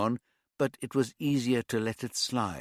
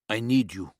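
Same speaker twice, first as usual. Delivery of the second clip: put-on light East European accent, blunt demand; a hint of an East European tough; 5 dB louder than usual. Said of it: "His accent was some sort of East European".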